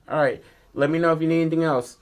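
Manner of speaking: high, quick waitress sign-off. Retold or imitated. Waitress leaving the boy with the check, high and breezy.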